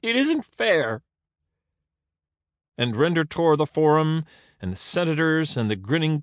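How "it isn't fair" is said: slow, high wailing complaint; sobbed. A narrator's reading. Caesar complaining to Render of unfairness, sobbing wail. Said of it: "he sobbed".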